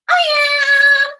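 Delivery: very high, squealed put-on voice; Rosa. Helper hopping in to count words, high put-on voice. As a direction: pipe up eagerly, high and drawn out. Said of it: "Rosa's gonna hop in".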